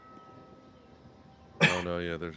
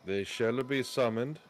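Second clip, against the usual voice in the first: slow, louder and higher, a pompous proclamation; mock regal lord; 6 semitones higher, 6 dB louder, slower than usual. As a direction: boom it out like a self-important lord. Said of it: "It's the wrong accent".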